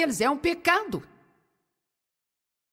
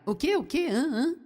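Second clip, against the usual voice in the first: quick higher-pitched questions, as if not catching it; puzzled listeners; 4 semitones higher than usual. Acted. Preacher mimics listeners who can't follow mumbled speech, puzzled. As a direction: mimic baffled people asking for a repeat. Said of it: "faz todos perguntarem".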